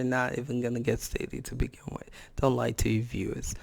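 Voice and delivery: hushed whisper aside to the viewers; whispered jab. Real, not acted. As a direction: drop to a whisper as if telling a secret.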